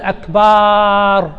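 "أكبار" with a long drawn-out aa after the b, "akbaaar"; slow demo of the mistake, dragging the last vowel of "أكبار"; lengthened ba. Shown as a demonstration. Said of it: "كذلك بعضهم يمد".

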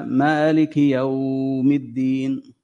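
مالك يوم الدين said slowly, with a small glide on يوم; slow demo. Shown as a demonstration. Teacher slowly modelling the recitation of مالك يوم الدين. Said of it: "هذا مد لين".

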